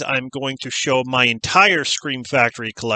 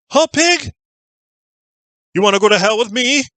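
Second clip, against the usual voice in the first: higher and louder, taunting; menacing villain quote; 10 semitones higher, 4 dB louder than usual. Retold or imitated. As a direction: sneer it at the cop like a killer who wants a fight. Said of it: "When you can quote lines from 'em".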